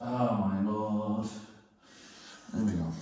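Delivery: slow low groan of dismay; weary dismay. Real, not acted. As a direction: drag it out, deflated. Dismay at screws.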